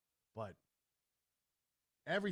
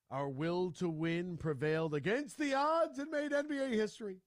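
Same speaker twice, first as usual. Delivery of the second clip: louder and higher, building to a triumphant finish; rousing proclamation; 5 semitones higher, 5 dB louder than usual. Acted. Host reading out a player's boastful tweet, rousing proclamation. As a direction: proclaim it like a victory declaration. Tweet read out with rising fervor.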